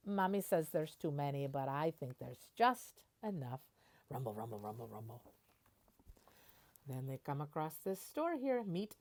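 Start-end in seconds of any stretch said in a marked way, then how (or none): 4.1-5.2 low rumble. Acted. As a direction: lower and softer rumbling, fading away. Motor rumble sound words.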